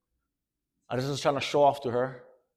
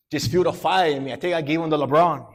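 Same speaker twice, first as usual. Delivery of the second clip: ordinary talk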